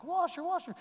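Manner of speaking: put-on high, excited little boy calling out; a child shouting a name with glee. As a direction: call out his name like an overjoyed kid running up. Child runs calling his name.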